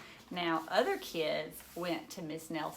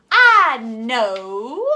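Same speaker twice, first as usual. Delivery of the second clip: loud eager burst; excited shout; 4 semitones higher, 19 dB louder than usual. Acted. Know-it-all kid blurts an idea, loud and eager.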